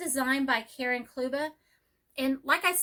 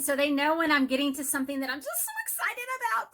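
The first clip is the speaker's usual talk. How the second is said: quick, excited gush climbing in pitch; louder, faster excitement; 3 semitones higher, faster than usual. Shown as a demonstration. Teacher showing how she gets over a favourite subject, fast and excited. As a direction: speed up and swell with enthusiasm. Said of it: "I get louder and I start talking faster".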